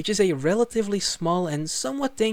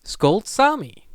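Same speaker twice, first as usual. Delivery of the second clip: slow, louder announcement of the wheel's pick; a higher, slow, loud reveal of Skolt Sami; dramatic reveal; 5 semitones higher, 5 dB louder, much slower than usual. Shown as a demonstration. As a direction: draw out Skolt Sami like a game show result. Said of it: "decided to spin this wheel".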